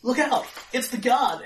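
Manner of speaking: high, loud cry of alarm; startled shout. Acted. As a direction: shout a warning as the door bursts in. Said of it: "he shouts out".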